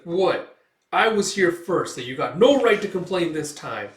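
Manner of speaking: high, loud, indignant little voice; Puck. Retold or imitated. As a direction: protest that you were here first. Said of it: "Puck's like".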